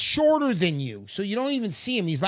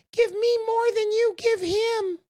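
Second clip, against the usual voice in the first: much higher piped little voice; tiny Uncle Sam voice; 14 semitones higher than usual. Retold or imitated. Uncle Sam butting in at the bank counter, tiny high voice. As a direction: squeak it up from down by the shins. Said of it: "the little voice says".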